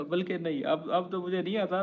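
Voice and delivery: ordinary delivery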